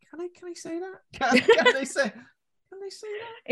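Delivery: very high, disbelieving squeal; incredulous. Retold or imitated. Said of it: "I was like".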